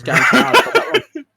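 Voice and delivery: high, loud and quick, breaking into laughter; laughing self-mockery. Real, not acted. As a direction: laugh your way through the admission. Laughs at own likely mistake.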